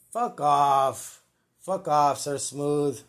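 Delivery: loud, hammed-up yell; mock outburst. Host yelling back at Sir Smooth, mock angry. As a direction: blow up at the boss.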